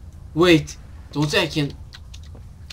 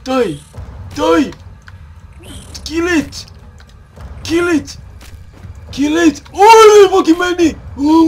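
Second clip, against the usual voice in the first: panicked high shouting while fleeing; being chased; 11 semitones higher, 13 dB louder than usual. Real, not acted. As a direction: yell in panic, running for your life.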